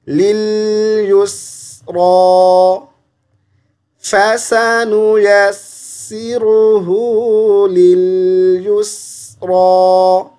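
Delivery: slow melodic tilawat chant; Quran recitation. Shown as a demonstration. Teacher recites the verse for the student, slow tilawat. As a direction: recite it slowly, drawing out each vowel.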